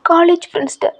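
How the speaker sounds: distraught weeping mother; crying aunt. Acted. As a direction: sob it out in despair. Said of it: "உங்க anti செம்மையா அழுகுறாங்க".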